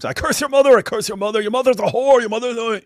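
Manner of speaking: high, mocking ranting voice. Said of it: "regular behavior from".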